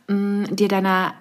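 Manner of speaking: normal talking voice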